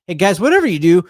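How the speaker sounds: loud, high, fast warning; urgent shout. Real, not acted. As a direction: call out to stop them now. Warning players about fumble pile.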